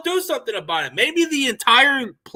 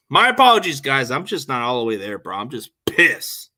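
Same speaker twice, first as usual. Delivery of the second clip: normal talking voice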